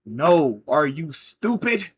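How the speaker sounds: slow, emphatic mock demand; condescending customer. Customer demanding the barista remove the cheese, condescending. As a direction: spell it out slowly, as if to someone stupid.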